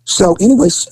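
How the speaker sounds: usual speaking voice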